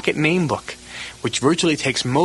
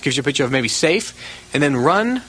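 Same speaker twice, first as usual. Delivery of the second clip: usual speaking voice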